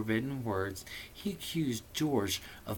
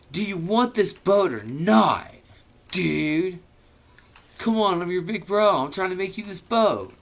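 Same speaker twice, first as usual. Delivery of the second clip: impatient big brother; 6 semitones higher, 3 dB louder than usual. Acted. Voicing Bill, big brother.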